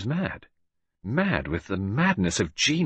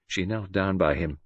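ordinary delivery, nothing marked

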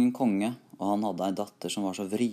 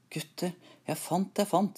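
keen, lifted storybook voice; excited announcement; 8 semitones higher than usual. A narrator's reading. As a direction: share a find with your companions, pitched high. Hero announces another find to brothers.

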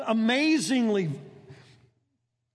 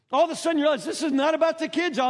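animated; 6 semitones higher than usual. Real, not acted. Exclaiming to the congregation.

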